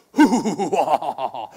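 loud hammy villain chuckle; evil cartoon laugh, loud; Scooby-Doo villain laugh. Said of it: "the Scooby-Doo bad guy laugh".

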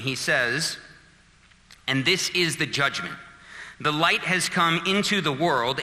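ordinary delivery

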